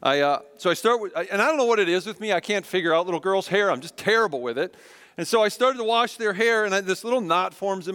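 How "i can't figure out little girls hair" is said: ordinary delivery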